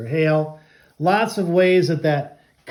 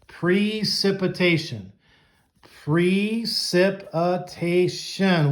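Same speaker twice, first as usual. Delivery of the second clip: slow syllable by syllable; slower than usual. Shown as a demonstration. Teacher slowing down a long word for young students. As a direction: draw out Precipitation slowly, each syllable separate. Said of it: "a lot of syllables".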